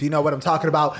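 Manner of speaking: normal talking voice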